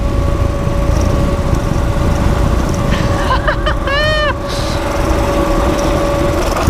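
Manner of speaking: breathless squealing laughter; high squealing laugh. Real, not acted. Laughing after a big bump.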